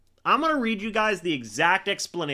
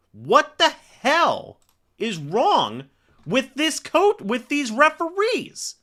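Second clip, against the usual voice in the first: shouted, high and loud, dragged out slowly; a real furious yell at the referees; furious outburst; 11 semitones higher, 5 dB louder, slower than usual. Real, not acted. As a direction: let the outrage at the refs explode. Streamer furious at referees' call.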